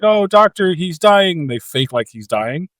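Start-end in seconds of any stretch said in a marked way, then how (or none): 0.0-1.4 mock alarmed nurse. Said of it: "And she's like".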